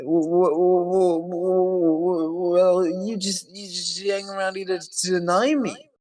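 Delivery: high, stuttering caricature; mock stammering complaint. Imitating her confronting people nearby, mock stammering whine. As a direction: stammer up high, then drag out the grievance.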